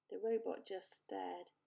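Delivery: usual speaking voice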